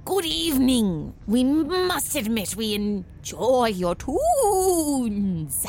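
vampire voice. Acted. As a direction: drawl it out like a theatrical vampire. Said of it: "making, like, scary faces".

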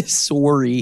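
mock Canadian sorry. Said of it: "That was so Canadian".

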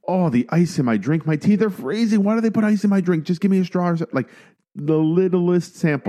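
high, whiny, overdramatic complaining; dramatic complainer. Retold or imitated. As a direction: whine about the ice like a diva.

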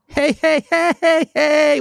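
high chanted hey hey hey; cheering. Pretend. Celebrating the fourth year with listeners, high cheering chant. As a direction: cheer like a party.

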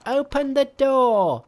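high, loud call at the gate; Monkey crying out. A narrator's reading. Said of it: "Monkey cried".

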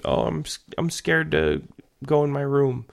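put-on high, whiny kid voice; whiny child. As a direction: mimic a child whining about bed. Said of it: "she complains".

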